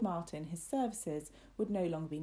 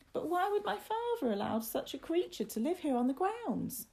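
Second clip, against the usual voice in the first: put-on very high, plaintive child voice; worried young William; 11 semitones higher than usual. Boy asks why Father allows the creature, high and worried. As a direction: question it high, like an uneasy little boy.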